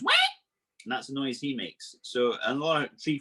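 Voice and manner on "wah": put-on short baby-like wah; quick mock baby wail; imitated frog cry. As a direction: mimic a frog that cries like a baby. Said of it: "he goes".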